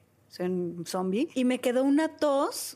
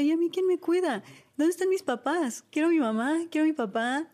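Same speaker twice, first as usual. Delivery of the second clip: higher-pitched mock plaintive cry; lightly acted wail of an orphaned child; retold bawling; 7 semitones higher than usual. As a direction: recall a tearful, childlike plea for care. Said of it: "empecé a berrear".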